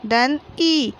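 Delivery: slow, letter-by-letter म धन छ धन ल धन ई; teacher slowly assembling मछली; slow spelling out. Shown as a demonstration. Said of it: "तो क्या हो गया".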